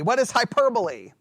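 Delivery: ordinary talk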